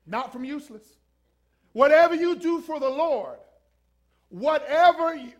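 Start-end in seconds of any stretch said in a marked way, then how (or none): 1.7-5.3 high, loud proclamation; forceful preaching. Real, not acted. Preacher proclaiming the verse to the congregation, loud and forceful. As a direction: ring the verse out over the congregation.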